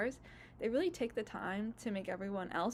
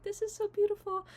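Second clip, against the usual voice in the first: quoting Ari; 11 semitones higher, 4 dB louder than usual. Retold or imitated. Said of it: "Ari's like".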